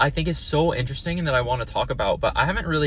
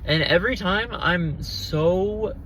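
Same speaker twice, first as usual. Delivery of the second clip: ordinary talk, nothing marked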